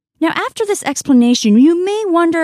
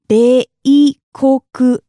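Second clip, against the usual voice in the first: "beikoku" very slowly and louder, syllables apart; 5 dB louder, much slower than usual. Shown as a demonstration. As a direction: break "beikoku" into separate strong beats. Teacher repeats word by syllables.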